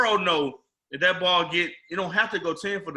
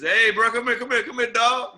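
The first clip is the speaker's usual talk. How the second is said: high, louder New Orleans street call; New Orleans talk; 6 semitones higher, 4 dB louder than usual. Retold or imitated. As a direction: call him over like a New Orleans local. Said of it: "You know how they talk".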